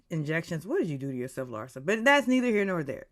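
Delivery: usual speaking voice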